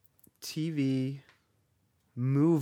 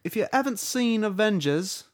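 Tom Holland; 8 semitones higher, 4 dB louder than usual. Retold or imitated. British actor warning trailer viewers, bad Cockney. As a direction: warn them in overblown British. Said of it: "Tom Holland comes on".

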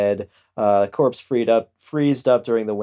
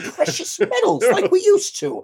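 high crank voice; 12 semitones higher than usual. Retold or imitated. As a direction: rant like a gold-bug uncle. Voicing crank sergeant.

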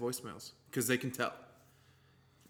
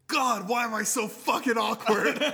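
awkward cringe; 9 semitones higher, 7 dB louder, slower than usual. Retold or imitated. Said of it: "you'll look at me like".

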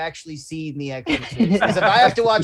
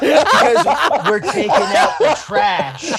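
slow, loud deadpan with a laugh; slow punchline; 8 semitones higher, 8 dB louder, much slower than usual. Acted. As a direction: drag the joke out slowly. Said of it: "That delivery was so fucking great".

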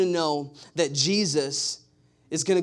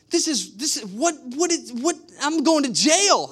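higher, louder panicked rambling; mock panic; 8 semitones higher, 4 dB louder than usual. Pastor re-enacting his texts to Abby, in mock panic. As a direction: play the frantic guy who thinks he's going to jail. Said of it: "and I'm like".

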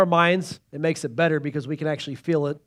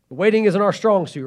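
ordinary talk

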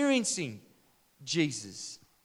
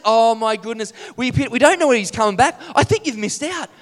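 excited disciple; 3 semitones higher, 10 dB louder than usual. Acted. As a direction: sound thrilled yet sorry for him. Another disciple voiced excitedly.

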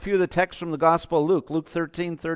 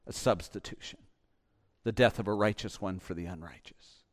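Jesus answering; 6 semitones lower than usual. Retold or imitated. Jesus answering those who ask why he didn't stop it, low and solemn. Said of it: "And Jesus' answer is".

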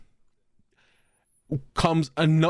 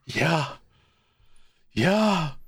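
ordinary delivery

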